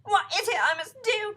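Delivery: high, loud plea; desperate cry. A narrator's reading. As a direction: beg for instructions. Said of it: "cried Missus Twit".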